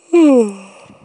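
huge loud drawn-out yawn; enormous yawn. Acted. Sleepy Mister Y greets the trolley with an enormous loud yawn. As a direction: let a giant yawn roll out. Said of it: "Mister Y let out an enormous yawn".

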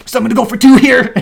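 loud, high play-acted excitement; excited runner. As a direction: call it out while charging to second. Acting running batter's excited chatter.

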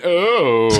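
high, drawn-out mock Takei oh; a lightly voiced George Takei, amused; imitating George Takei. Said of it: "And he went".

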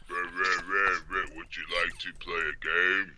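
low, soft giant voice; Andre the Giant impression. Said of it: "Why does he sound like Andre the Giant all of a sudden".

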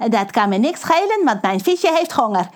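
lightly sneering, callous; mean. Acted. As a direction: brush off the giant cruelly. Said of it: "zegt Pedro gemeen". (Pedro gemeen en onverschillig)

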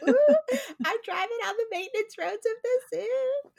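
very high, hushed-thrill mock squeal; giddy secret-club squeal. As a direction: squeal it like sneaking somewhere forbidden. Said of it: "You're like".

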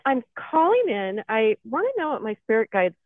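ordinary delivery

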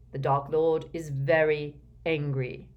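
ordinary delivery

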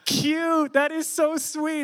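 high, slow, squealing gush; sudden high squeal of delight; gushing girl cop. Retold or imitated. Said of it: "turns from serious mode and just goes".

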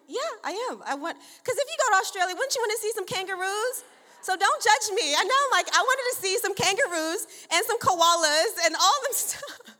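high, loud and eager; excited. Real, not acted. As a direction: insist on the kangaroos, thrilled. Defending wish for kangaroos.

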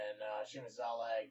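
ordinary talk, nothing marked

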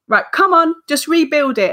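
high, chivvying come-on voice; brisk self pep talk. Retold or imitated. Guest replaying her Lego pep talk, high brisk chivvy. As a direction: gee yourself up, pitched high.

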